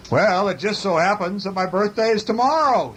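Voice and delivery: high storybook bear voice; calling bear. A narrator's reading. Bear calls across to the moon.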